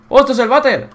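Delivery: high, loud burst of discovery; delighted surprise. Real, not acted. Player discovering the toilet, high excited surprise. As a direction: exclaim it in amazed delight.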